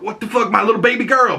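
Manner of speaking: high, fast mock protective dad; dismayed dad. Joel seeing daughter grown, high fast dismay. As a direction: squawk it as a dismayed father. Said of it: "Joel's like".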